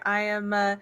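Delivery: ordinary talk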